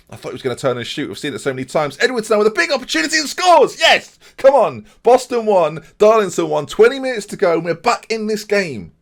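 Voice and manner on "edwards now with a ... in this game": high, loud shouting commentary; elated yell, pitch right up; ecstatic goal call. Real, not acted. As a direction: roar the equaliser in celebration. Celebrating an equalising goal live.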